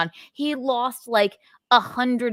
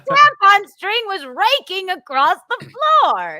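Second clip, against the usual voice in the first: laughing; 13 semitones higher, 9 dB louder, slower than usual. Real, not acted. Laughing retelling airport story.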